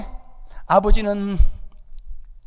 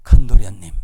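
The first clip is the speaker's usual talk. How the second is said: servant calling out; 10 semitones lower, 9 dB louder than usual. Acted. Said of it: "종이 쪼르륵 달려가서".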